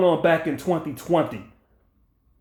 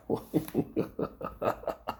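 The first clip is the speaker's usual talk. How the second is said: silent scoffing laugh; 12 dB quieter than usual. Real, not acted. Mocking the date night picture.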